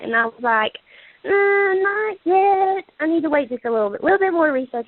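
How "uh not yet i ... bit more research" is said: hesitant stalling. Retold or imitated. Retelling her hesitant reply.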